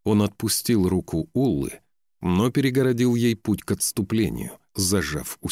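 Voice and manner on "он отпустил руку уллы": plain reading voice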